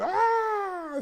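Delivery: high-pitched mock scream of fear; a sudden high frightened yelp, hammed up; retold scream of fright. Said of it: "y gritaron".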